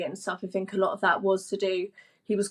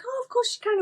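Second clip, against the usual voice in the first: lightly voiced gracious wife, pitched up high; a hint of an obliging, high-pitched wife; Christine being gracious; faster than usual. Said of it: "She was like".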